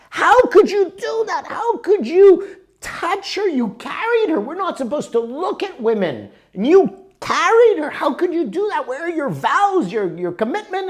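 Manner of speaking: high, loud outraged scolding; a monk bursting with outrage; exploding monk. Retold or imitated. Monk scolding his companion, high and loud. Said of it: "the other monk explodes".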